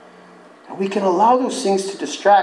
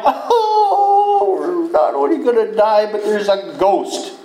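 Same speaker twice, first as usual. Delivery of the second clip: high, frantic mock terror; a hammed-up cry of ghost panic; panicked disciples; 10 semitones higher, slower than usual. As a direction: squeal it like scared fishermen. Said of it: "what's the first thing that comes to their mind".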